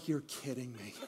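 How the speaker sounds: very quiet, sunken "You're kidding me"; deflated disbelief. Retold or imitated. Draftee picked by the Lions, quiet deflated disbelief. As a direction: let it drop out of you, barely audible. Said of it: "he's going".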